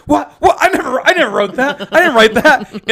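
high, fast flustered denial with a laugh; shook up. Retold or imitated. Rob denying he wrote the ham line, flustered and high. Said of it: "he just gets all shook up".

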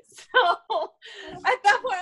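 words pushed out through laughter; laughing. Real, not acted. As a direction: still laughing while starting to talk.

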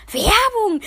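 high, loud burst; excited exclaim. Real, not acted. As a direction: blurt it out with glee. Exclaiming about free advertising.

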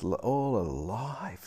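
breathless whisper; a whispered aside about the caller. Retold or imitated. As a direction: breathe it out excitedly under your breath. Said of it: "she's just".

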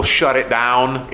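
put-on slow Canadian-New York accent on shut it down. Friend repeating the Die Hard line in a Canadian-New York accent. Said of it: "in a Canadian New York accent".

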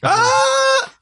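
high shrill yell of panic; Will Smith scream. Retold or imitated. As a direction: let out a sudden high scream. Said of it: "He does Will Smith scream".

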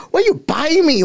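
high-pitched mocking mom voice; imitating his mom. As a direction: mom teasing her son for complaining. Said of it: "my mom's like".

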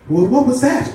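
high, rising startled question; shocked. Acted. Helen just kissed on the cheek, shocked and high. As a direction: blurt it out, pitch shooting up. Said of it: "with a shocked look on her face".

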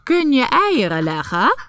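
mocking hen. A narrator's reading. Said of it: "vroeg ze".

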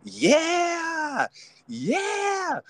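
high squealed yeah yeah; mocking the women. Podcaster mimicking women cheering, high squeal. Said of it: "the women were saying".

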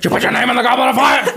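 high, rushed accusation; hammed-up breakneck confrontation; frantic mock yell. Dumbledore running over to Harry, fast mock yell. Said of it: "he just runs over to him".

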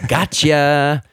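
loud, high teasing call; mock-triumphant tease, sung out loudly; playful gotcha. Playful teasing after echoed joke.

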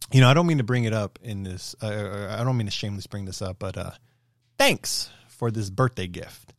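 dry, sarcastic thanks to the boss. Shown as a demonstration. To his boss, re-enacting a sarcastic birthday thank-you. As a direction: say it exactly as he did, with a sarcastic edge. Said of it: "in a very sarcastic tone, literally like this".

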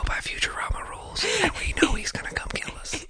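spooky conspiratorial whisper. Real, not acted. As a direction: whisper the secret fearfully. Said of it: "Why did we start whispering?".

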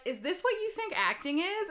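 teacher scoffing at a student's acting; mocking teacher. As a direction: sneer it like a fed-up drama teacher. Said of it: "he would be like".

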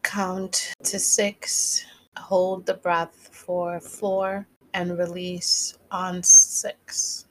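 slow, measured meditation guide; guided breathing. Real, not acted. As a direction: lead listeners calmly through a breath, slowly. Leads a breathing exercise.

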